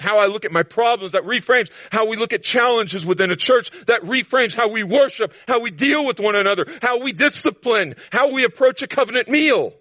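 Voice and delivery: high and loud, driving each line home; full-force preaching. Acted. Preacher to the congregation, declaiming high and loud. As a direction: preach it out, rising with every phrase.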